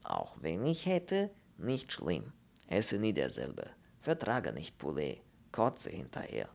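placid foreign voice in broken German; Polish character. Acted. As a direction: state your dislike in halting phrases. Pole speaking broken German.

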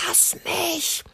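put-on high whispered child's protest. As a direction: hiss it like a struggling kid. Kind wehrt sich. (hoher geflüsterter Kinderprotest)

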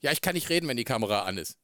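ordinary delivery

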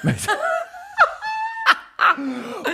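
high, helpless shrieking laugh. Real, not acted. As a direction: crack up completely. Helpless laugh at own joke.

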